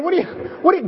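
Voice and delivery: normal talking voice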